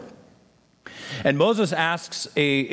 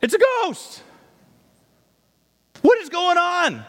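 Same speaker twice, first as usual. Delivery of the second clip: much higher, panicked scream of ghost-fright; screaming in terror; 3 dB louder than usual. Acted. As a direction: shriek like terrified disciples seeing a ghost. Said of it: "screaming in terror".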